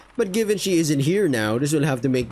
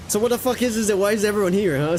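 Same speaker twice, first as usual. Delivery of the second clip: higher, startled demand; surprised crewman; 5 semitones higher than usual. Acted. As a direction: burst in, thrown by the crowd.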